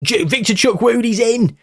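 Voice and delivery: high, urgent shout as he breaks through; commentating a breakaway, shouting; excited shout. Real, not acted. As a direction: yell it as the chance opens up. Striker through on goal.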